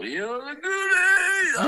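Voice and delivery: slow, rising whooped shout; excited shout. Real, not acted. [name] cheering when asked about the Goonies, excited shout. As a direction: yell it out like a huge fan.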